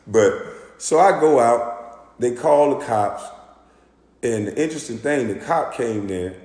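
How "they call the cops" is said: usual speaking voice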